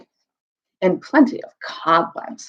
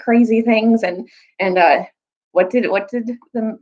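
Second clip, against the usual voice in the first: ordinary delivery, nothing marked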